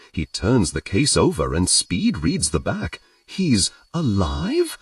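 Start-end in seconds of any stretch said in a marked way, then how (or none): 3.3-4.8 high, slow, hopeful question; hopeful disbelief at good news. A narrator's reading. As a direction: ask it hardly daring to believe. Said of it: "The note of hopefulness in his voice".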